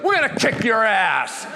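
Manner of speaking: loud ferocious gloat at the rival; gleeful taunting yell. Retold or imitated. Yelling a taunt at the rival boss. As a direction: shout it right in his face. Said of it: "right in his face like this".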